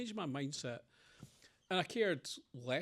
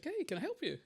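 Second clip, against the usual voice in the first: bright, much higher friendly call; cheery offer; 14 semitones higher than usual. Retold or imitated. A young man calling to a stranger with a flat tire, high cheery voice. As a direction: sing out an eager offer of help.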